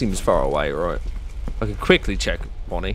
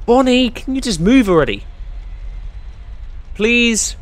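exasperated pleading; 11 semitones higher than usual. Real, not acted. Begging a slow game character.

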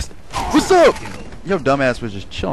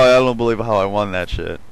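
ordinary talk

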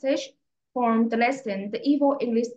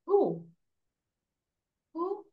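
high, soft coaxing who; coaxing prompt; 6 semitones higher, 5 dB quieter than usual. Acted. Teacher gently coaxing a nine-year-old to answer. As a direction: gently nudge the child to answer.

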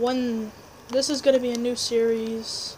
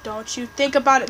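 ordinary delivery

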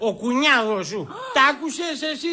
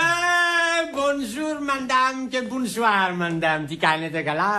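put-on slow, drawn-out drunken French hello; long tipsy vowels, sliding down at the end; blind-drunk greeting; slower than usual. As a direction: greet the lady with sozzled gallantry. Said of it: "είναι τύφλα μεθυσμένος".

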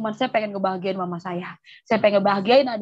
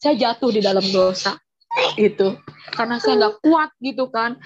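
talking through tears, louder; words pushed out while crying; real crying; 4 dB louder than usual. Real, not acted. Woman crying while recalling how she fell into sin. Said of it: "saya kecewa".

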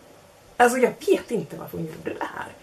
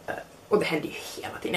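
ordinary delivery

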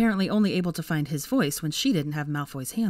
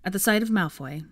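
ordinary delivery

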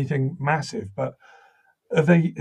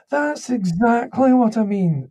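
much higher Scottish voice, pleased; little voice down the line; 10 semitones higher, 4 dB louder than usual. Retold or imitated. Scottish writer confirming the accent to the actor, little high voice. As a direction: agree brightly as the little Scottish voice. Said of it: "little voice down the line said".

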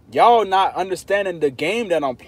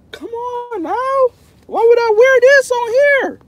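very high, loud hammed-up yell; playful mock shout; 11 dB louder, slower than usual. To chat questioning his hat, high playful mock shout. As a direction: holler it in mock disbelief. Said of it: "I'm playing, I'm not upset".

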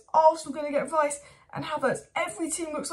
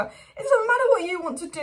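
frustrated shout; 6 semitones higher, 5 dB louder than usual. Real, not acted. Fan ranting, voice cracking.

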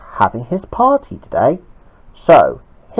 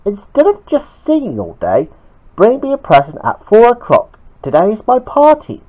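higher, put-out demand; pitched-up storybook grumble; lightly voiced Bobby; 10 semitones higher than usual. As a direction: complain like an indignant story character.

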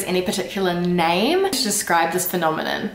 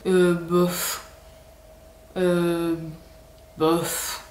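shrugging, careless bouf; indifferent bouf; much slower than usual. Shown as a demonstration. Showing learners the shrugging bouf. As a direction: toss off a bored bouf. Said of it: "So it's like".

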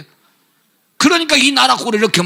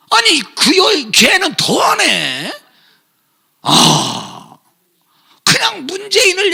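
분노에 찬 느린 외침; outraged shout; 8 semitones higher, slower than usual. Real, not acted. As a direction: drag it out in disgust. Preacher outraged at Kim's sister.

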